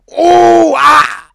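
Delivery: loud high cry of pain; sudden anguished yell; pained scream. Retold or imitated. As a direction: scream out as you wake hurt. Waking up shot, in handcuffs.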